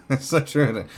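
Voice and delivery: loud, high, through a laugh; real amused laughter in the words. Real, not acted. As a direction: say it while cracking up. Still laughing at the quote.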